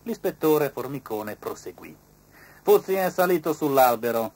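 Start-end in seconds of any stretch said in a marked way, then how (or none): 2.6-4.3 inspector's voice. A narrator's reading.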